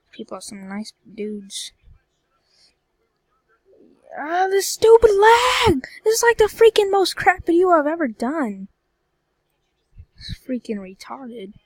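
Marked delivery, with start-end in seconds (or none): 4.2-8.7 frustrated shout. Real, not acted. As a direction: yell at the stupid lag in real frustration. Furious at game lag.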